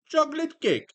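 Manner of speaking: put-on high, loud Italian accent. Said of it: "Well, you just did".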